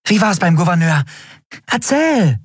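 high, eager questions tumbling out; a small creature bursting with curiosity; excited. Acted. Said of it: "flackerten vor Aufregung".

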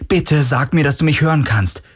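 high, pleading call; urgent appeal without shouting. A narrator's reading. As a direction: call out, begging for an answer. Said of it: "rief er wieder".

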